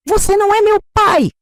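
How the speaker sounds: very high, loud furious scream; shouting. A narrator's reading. Bruno screams at his father while pushing him, furious. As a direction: scream it as you shove him away. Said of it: "Bruno gritou".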